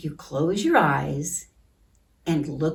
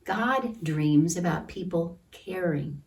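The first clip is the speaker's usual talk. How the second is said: storybook reading. A narrator's reading. Teacher reading a picture book to children, gentle.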